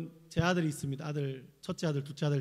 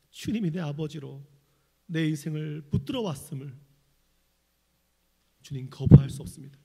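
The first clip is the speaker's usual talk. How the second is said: pastoral prayer; 5 dB louder than usual. Acted. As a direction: lead the congregation in prayer. Leads closing prayer.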